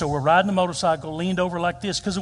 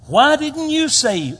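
high, loud, drawn-out challenge; challenging question; 10 semitones higher, 8 dB louder, slower than usual. Acted. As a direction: demand it slowly, voice pitched up. Acting Jesus challenging the disciples.

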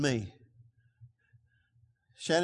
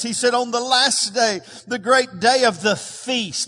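raised, fervent pulpit voice; preaching; 5 semitones higher, 4 dB louder than usual. Acted. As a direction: preach it out to the congregation. Preacher quoting Jesus.

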